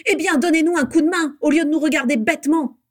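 loud, high, impatient rebuke; scolding. A narrator's reading. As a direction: chide them hard for standing idle. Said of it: "le houspilla Bellatrix". (réprimande vive et agacée)

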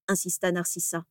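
usual speaking voice

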